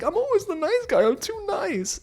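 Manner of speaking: very high, cracked crybaby wail; mock sobbing whine. Edmund crying to Calabria, very high mock wail. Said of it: "he starts, like, crying".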